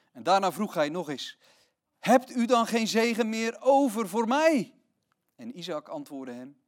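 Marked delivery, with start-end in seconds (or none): 2.0-4.7 pleading question. Acted. Esau begs for a blessing.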